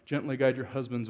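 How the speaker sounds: ordinary delivery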